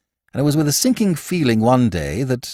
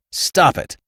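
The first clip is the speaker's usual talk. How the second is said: sudden stop; 6 semitones higher than usual. A narrator's reading. Bill abruptly hushing Archie as Reggie nears, sharp. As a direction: stop him short. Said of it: "said Bill suddenly".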